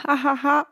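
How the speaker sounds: bursts of high giggling; high giggle. Real, not acted. Laughing at herself mid-podcast.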